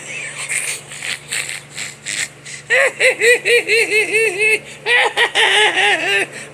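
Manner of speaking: long, high wheezing cackle; helpless laughter. Real, not acted. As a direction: crack up completely, laughing in waves. Breaks up at son's reaction.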